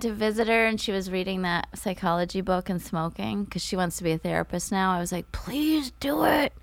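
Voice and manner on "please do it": higher, drawn-out mock plea; pleading. Viewer urging TV characters on, pleading. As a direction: beg for it, stretching the words.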